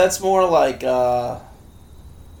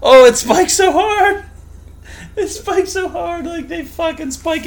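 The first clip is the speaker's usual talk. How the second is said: high excited squeal; 12 semitones higher than usual. Real, not acted. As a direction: shriek it out, pitched way up. Reacting to sound spike.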